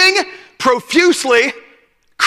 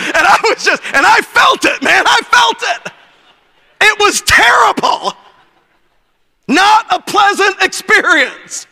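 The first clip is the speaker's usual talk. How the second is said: preacher shouting at the crowd; rising shout; 6 semitones higher than usual. Real, not acted. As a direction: drive it home with a raised voice. Preacher building on the beating.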